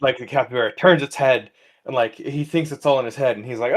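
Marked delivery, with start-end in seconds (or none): none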